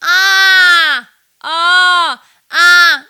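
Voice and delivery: twangy ah. Shown as a demonstration. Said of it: "back of my throat".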